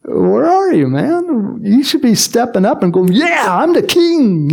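high, teasing call to a shy man; mock urging. Preacher teasing Saul to step up, high and playful. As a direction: egg him on, playful and high.

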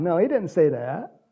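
ordinary delivery

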